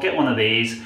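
ordinary talk, nothing marked

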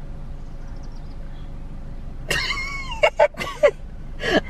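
helpless squeaky giggle; breaking up in silent laughter; high unvoiced laugh. Real, not acted. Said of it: "I can't keep a straight face".

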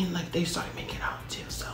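hushed, conspiratorial aside; whispered gossip. Real, not acted. Storyteller shares a secret with listeners, whispered.

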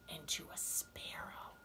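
hushed storyteller whisper. A narrator's reading. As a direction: breathe the name for suspense. Suspense before new animal.

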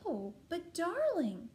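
high, loud, drawn-out royal dismay; gasping queen. A narrator's reading. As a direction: gasp it slowly as a storybook queen. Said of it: "gasped the queen".

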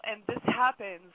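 ordinary talk

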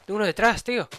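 high startled yell; alarmed shout. Real, not acted. Enemy behind.

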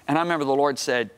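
usual speaking voice

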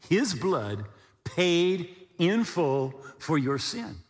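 slow, each word laid down; slow weighty phrase. Shown as a demonstration. Preacher to believers, slow weighty stress on paid in full. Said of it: "key phrase slowed".

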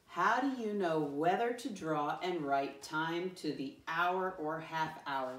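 measured model reading, word by word; slow read-aloud. Shown as a demonstration. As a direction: read slowly and deliberately for a class. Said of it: "Let me read it the first time".

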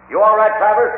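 loud, high call down a shaft. Acted. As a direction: call down to a trapped man below. Calling down to trapped man.

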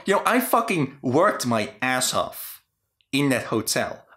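ordinary talk, nothing marked

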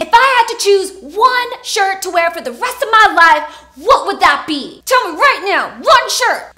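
high, loud pretend yelling; shrill demand at gunpoint, in jest; mock screaming interrogator. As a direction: bark it at him like a captor. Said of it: "that person was screaming at me".

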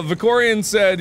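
normal talking voice

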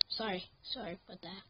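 soft, low "sorry"; sheepish apology. Real, not acted. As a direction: drop right down, abashed after an outburst. Apologises after loud outburst.

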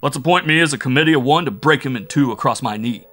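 loud threat. Acted. Big fellow threatens the captive.

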